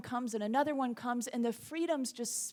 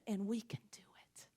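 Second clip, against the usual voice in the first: hushed, breathy whisper; 3 semitones lower, 6 dB quieter than usual. Real, not acted. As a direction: lean in and whisper it as a shared promise. Quiet closing encouragement to congregation.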